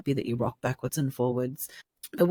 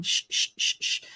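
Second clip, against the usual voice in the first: soothing shushing. Shown as a demonstration. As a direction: shush gently, over and over. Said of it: "just a parent shushing over and over again".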